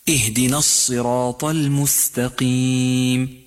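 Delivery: slow, chanted اهدنا الصراط المستقيم, heavy ṣad; Quran recitation. Shown as a demonstration. Teacher recites the verse as a model. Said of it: "اقرأ هكذا".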